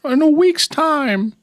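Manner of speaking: very high, drawn-out silly voice; playful high-pitched echo; mock sing-song echo. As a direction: stretch it out high and goofy. Drawn-out high mocking echo of partner.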